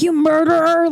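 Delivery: high, hammed-up accusation; mock accuser. Imitating people calling someone names, mock accusing.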